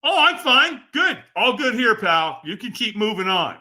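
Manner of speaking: high, falsely breezy brush-off; feigned okayness. Acted. Nervous participant sending the helper away, falsely fine. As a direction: wave them away as if all is fine. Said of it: "when we're not okay, what do we say".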